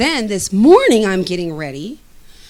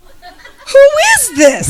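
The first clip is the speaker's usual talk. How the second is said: much higher, louder, very slow laughing shout of "Who is this?"; delighted squeal; 10 dB louder, much slower than usual. Retold or imitated. As his texts keep coming, a delighted laughing shout. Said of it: "I'm like".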